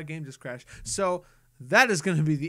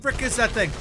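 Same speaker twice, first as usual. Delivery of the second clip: sudden loud alarm, higher; real jolt of alarm at a creature; startled; 5 semitones higher, 6 dB louder than usual. Real, not acted. Gamer spotting a strange monster, loud startled alarm.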